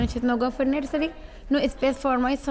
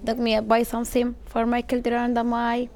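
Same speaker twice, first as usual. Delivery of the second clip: Sudanese accent. Real, not acted.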